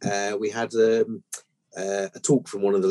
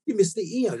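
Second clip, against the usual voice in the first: much higher, outraged customer voice; indignant complaint; 12 semitones higher than usual. Retold or imitated. Angry customer complains about headstone.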